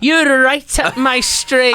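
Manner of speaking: put-on high, loud, slow Liverpool accent; drawn-out Scouse impression. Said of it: "famously what [name] said".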